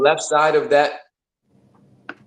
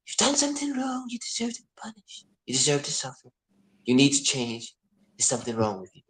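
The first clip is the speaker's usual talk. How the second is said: changed voice of guilt; 2 semitones higher, 7 dB quieter than usual. Acted. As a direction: softly accuse like a creepy whispering conscience. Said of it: "Oh, let me change my voice".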